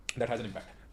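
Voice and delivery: ordinary delivery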